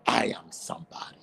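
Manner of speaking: breathy whisper, low and intimate; a hushed private affirmation. Real, not acted. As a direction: breathe it like a secret vow.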